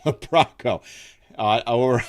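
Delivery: real laughter breaking into the words; laughing. Real, not acted. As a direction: try to read the name, crack up. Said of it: "sorry, I'm laughing".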